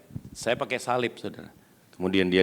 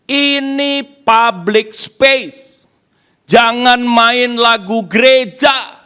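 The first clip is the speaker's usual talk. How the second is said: high, loud, drawn-out yelling in protest; imitated angry shout; 12 semitones higher, 9 dB louder, much slower than usual. Preacher imitates an American interrupting the pianist, high slow shouting. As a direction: yell like an outraged hotel guest, stretching the words. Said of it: "tiba-tiba ada orang teriak".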